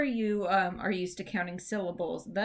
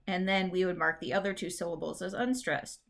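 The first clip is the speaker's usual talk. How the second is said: normal talking voice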